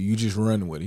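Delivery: ordinary delivery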